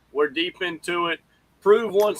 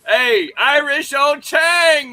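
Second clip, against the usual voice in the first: calling out; 9 semitones higher, 6 dB louder, much slower than usual. Real, not acted. Host calling out on show.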